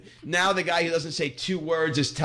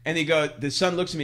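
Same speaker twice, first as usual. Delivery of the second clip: ordinary talk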